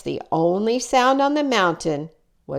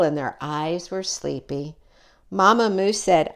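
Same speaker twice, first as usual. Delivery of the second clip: ordinary delivery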